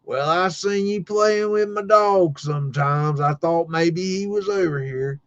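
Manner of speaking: high, drawling farmer voice; old school farmer. Retold or imitated. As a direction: stretch it out like an old country neighbor. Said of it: "he was old school farmer".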